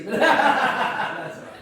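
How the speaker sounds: high, loud laughing reply; breaking into a high laugh. Real, not acted. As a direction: laugh it off in good humour. Laughs off the crazy remark.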